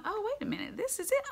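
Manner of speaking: high-pitched dawning recognition; sudden realization. Acted. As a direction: let it click, voice leaping way up. Acted sudden realization by Joseph.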